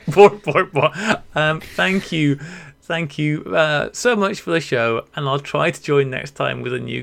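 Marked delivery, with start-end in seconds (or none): 0.0-0.9 Bork, bork, bork laughed out louder; giggling mock Swedish chef; laughing Swedish chef bork. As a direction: echo the chef's bork while laughing. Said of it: "like the Swedish chef".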